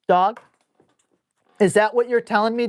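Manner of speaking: normal talking voice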